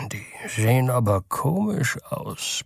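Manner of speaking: put-on slow, soft old-man grumble; old man complaining. Newborn old man grumbles at the clothes, slow and soft. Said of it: "beschwerte er sich".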